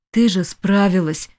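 usual speaking voice

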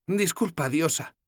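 voiced young heroine, gently teasing; Sefawin's voice. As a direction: play the tired, teasing young woman.

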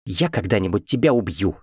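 ordinary delivery

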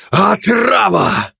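high, drawn-out shout of alarm; crying out. A narrator's reading. Commando sees glutton convulsing, crying out. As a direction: shout the warning slowly and high. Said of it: "вскричал".

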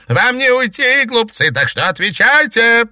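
very high, loud shrieking; furious scream. A narrator's reading. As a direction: scream the threat. Witch screams at them.

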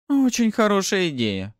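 high-pitched, scornful agreement; dismissive snort. A narrator's reading. Newcomer waving the narrator away, snorting. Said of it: "фыркнула та".

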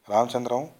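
urgent call of Ramachandram to the dying friend. A narrator's reading. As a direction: call Ramachandram out to a man slipping away. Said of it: "అని పిలిచాడు నాగభూషణం".

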